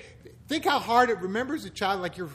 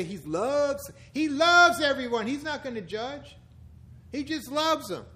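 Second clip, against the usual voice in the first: mock permissive believer; 5 semitones higher, 4 dB louder than usual. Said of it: "People say".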